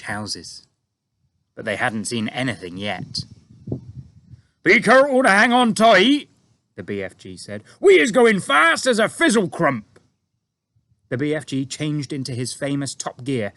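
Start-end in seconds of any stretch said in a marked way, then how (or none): 4.6-6.3 booming high giant voice, loud; BFG giant voice. A narrator's reading. BFG voiced in read story. 7.8-10.0 booming high giant voice, loud; BFG giant voice. A narrator's reading. BFG voiced in read story.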